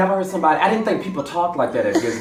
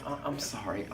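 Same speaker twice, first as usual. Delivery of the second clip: quiet apology; 4 semitones lower, 16 dB quieter than usual. Retold or imitated. Apologizing to cashier.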